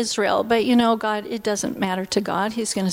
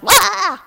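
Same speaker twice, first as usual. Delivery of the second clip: mock wail; 9 semitones higher, 7 dB louder than usual. Enemy pushing her buttons.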